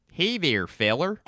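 hammed-up Midwestern twang, high and loud; Midwestern accent. Shown as a demonstration. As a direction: greet in a cartoon Midwestern accent. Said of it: "my Midwestern accent".

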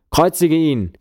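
1 crowd roar. A narrator's reading. The crowd roars.

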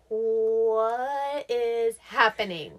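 drawn-out, slow "What is happening?"; slow bewildered question. Retold or imitated. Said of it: "And I was like".

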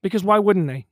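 loud, fast, heated rant; heated outburst. Real, not acted. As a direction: push it out fast and loud in frustration. Heated rant about war.

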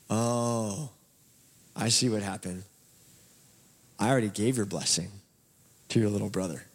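put-on low, halting old father; dismayed realization. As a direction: let it sink in, pausing between phrases. Blind father realizes he was tricked.

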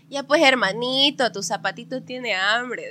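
lightly voiced jokey father teasing; dad's joking voice. Dad teasing little sister about her shoe, slow and playful. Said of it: "mi papá siempre ha sido gracioso".

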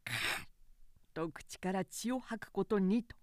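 plain reading voice

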